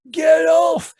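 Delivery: very high, loud yell; shouting. Acted. Teenage lad shouts at the driver in the struggle, high and loud. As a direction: bellow at the man to get off. Acted car fight.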